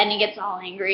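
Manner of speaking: ordinary delivery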